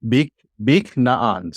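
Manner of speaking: "bik naan" slowly, no vowel between k and n; silent shwa. Shown as a demonstration. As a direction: close "bik" on the k, then "naan". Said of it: "this shwa is a silent shwa".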